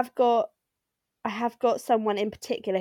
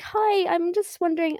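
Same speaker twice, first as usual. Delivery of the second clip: high, sweet customer message voice; 8 semitones higher than usual. Retold or imitated. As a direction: read it like a polite customer. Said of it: "I fully become, like, a different per".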